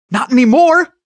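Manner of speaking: put-on high, loud boyish outburst; excited. A narrator's reading. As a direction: jump in loudly with delight. Boy thrilled at the news.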